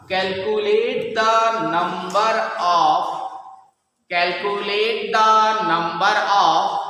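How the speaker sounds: slow, word-by-word dictation for students to copy. Acted. Teacher dictating a question to the class, slow and measured. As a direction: spell it out slowly so the class can write.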